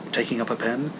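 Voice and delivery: usual speaking voice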